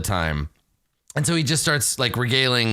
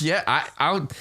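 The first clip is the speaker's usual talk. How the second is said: slightly raised, stammering agreement; starstruck; 4 semitones higher than usual. Acted. Game master as fan stammering to his idol, mildly starstruck. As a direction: stumble eagerly into saying yes. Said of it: "just starstruck".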